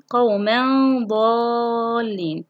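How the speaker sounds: very slow قوما ضالين, hum then long vowel; tanween ikhfa. Shown as a demonstration. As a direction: hide the tanween and stretch ضالين. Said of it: "في حالة التنوين". (إخفاء التنوين بغنّة ومدّ طويل في قوما ضالين)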